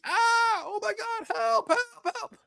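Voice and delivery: high-pitched panicked cries for help; screaming for help. Retold or imitated. Dad imitating his daughter's panicked screams for help. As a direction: shriek it like a hurt child.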